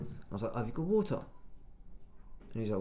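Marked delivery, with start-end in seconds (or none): 0.5-1.2 higher-pitched questioning quote; re-enacted customer query, pitched up; retold polite request. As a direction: lift the pitch as if asking a shopkeeper.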